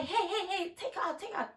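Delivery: high, soft, giggling mock order; laughing imitation. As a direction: laugh through his command. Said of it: "he's like".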